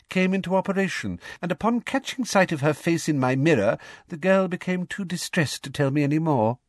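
high, precise reporting voice; sugary official, deliberate; prim, sickly-sweet Umbridge. A narrator's reading. Voices Umbridge giving her report.

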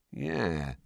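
soft, drawn-out agreement; slowly. A narrator's reading. Fred thinking over George's explanation, slowly. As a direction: let it out slowly and quietly. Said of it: "said Fred slowly".

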